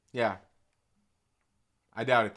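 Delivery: ordinary talk, nothing marked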